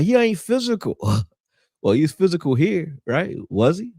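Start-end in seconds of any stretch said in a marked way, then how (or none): 0.0-1.0 mocking the critics. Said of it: "They were like".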